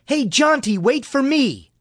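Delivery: put-on high-pitched boy calling after someone. A narrator's reading. Little Bud running after the big boys, calling out. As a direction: call out high and eager to be heard. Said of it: "Bud called".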